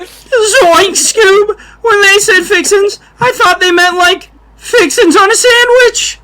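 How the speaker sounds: squeaky, giggly Scooby-Doo sidekick voice; goofy cartoon voice. Host riffs a goofy cartoon voice to make friends laugh, way up high.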